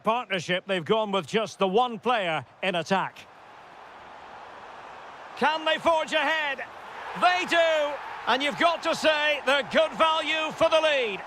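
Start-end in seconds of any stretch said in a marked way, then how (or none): 5.4-11.2 high-pitched, thrilled commentary; excited goal call. Acted. Commentator hailing a team going ahead, excited.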